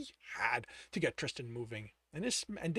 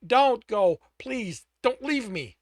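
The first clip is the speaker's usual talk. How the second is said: desperate plea; 7 semitones higher, 10 dB louder, slower than usual. Acted. Tristan begging.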